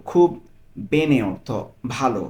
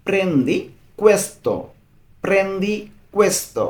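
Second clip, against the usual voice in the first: very slow, a bit higher; slow model phrase; 6 semitones higher, much slower than usual. Shown as a demonstration. Teacher models the phrase for a learner, slow and bright. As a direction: stretch it out clearly. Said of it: "এর Italian".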